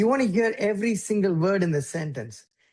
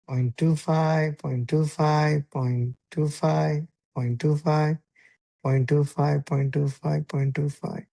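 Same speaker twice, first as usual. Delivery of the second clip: slow, even gaps in point two five; slow spaced demo; 3 semitones lower, slower than usual. Shown as a demonstration. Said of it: "or it can even be".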